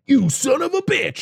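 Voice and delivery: high-pitched hammed-up accusation; mock outrage. Jim confronting Ed in the backyard, mock-outraged. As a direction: accuse him in comic fury.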